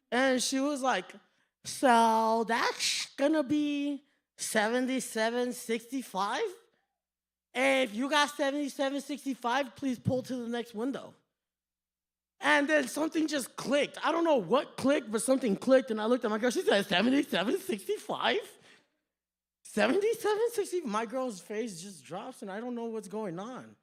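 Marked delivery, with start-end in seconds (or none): 1.6-11.1 slurred speech round orthodontic headgear; drive-thru worker in headgear. Retold or imitated. Worker reading the total over the speaker, headgear slur. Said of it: "had this whole headgear". 16.9-20.8 mocking echo of a headgear slur; mock headgear speech. Joker repeating the total to his girlfriend, headgear impression. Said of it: "had this whole headgear".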